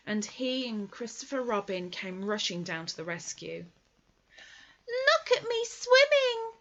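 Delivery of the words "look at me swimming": very high, slow squeak of delight; a tiny joey showing off; squeaky Roo. Acted. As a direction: Roo calling from the water, squeaky and proud. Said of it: "squeaked Roo".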